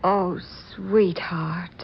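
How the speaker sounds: slow whispered comfort; soft, slow whisper of sympathy; tender whisper. Acted. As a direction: breathe it to him gently. Hears he lost patent.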